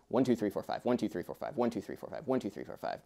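rapid, even counts of five; really fast counting. Shown as a demonstration. Teacher showing how quintuplets feel, really fast counting. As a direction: rattle off fives in an unbroken run. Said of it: "really fast".

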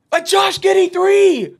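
very high, loud, slow stretched cry; ecstatic shout. Real, not acted. Commentator as Bulls stay alive on a three, ecstatic shout. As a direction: scream the big three long and high.

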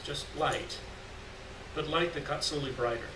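usual speaking voice

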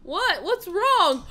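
usual speaking voice

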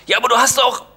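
high, loud indignant protest. Acted. Son objecting to father, loud high protest. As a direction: burst in to object. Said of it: "setzte ich an".

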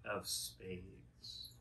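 very slow, drawn-out words. Acted. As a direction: stretch each word out. Stretching the card name.

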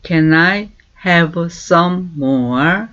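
very slow, clear English for learners to copy; slow model phrase. Shown as a demonstration. Teacher slowly modelling a question. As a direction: stretch each word for repeating. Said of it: "이렇게 말하고 있어요".

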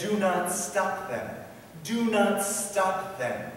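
very slow, deliberate quote; emphatic scripture command. Acted. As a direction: spell out the command slowly.

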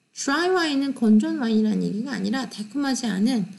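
ordinary talk

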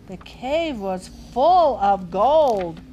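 slow, loud and amazed; awed marvel. A narrator's reading. Reader to children, slow loud wonder. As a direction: savour the wonder of the gold.

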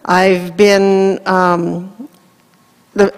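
ordinary talk, nothing marked

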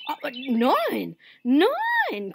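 loud, high startled denial; flustered iguana, loud and stretched; crying out. A narrator's reading. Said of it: "cried Iguana".

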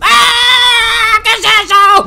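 high, loud frightened yelp; startled shout. Real, not acted. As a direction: shriek in real fright at the giant turtle. Susto ante tortuga gigante. (grito de susto agudo)

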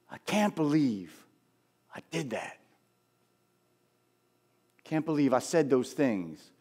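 soft, halting regret; subdued disbelief. Acted. Peter sitting alone with what he did, hushed regret. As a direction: let it drop quiet, pausing between phrases. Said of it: "I can imagine he's sitting there saying".

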